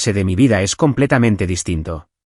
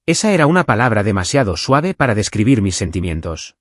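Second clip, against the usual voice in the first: plain reading voice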